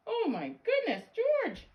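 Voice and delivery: high, loud exclamation falling away; shocked dismay. Acted. Vet finding a cat inside George, high shock. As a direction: cry out, scandalised, high and loud.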